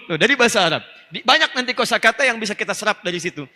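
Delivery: normal talking voice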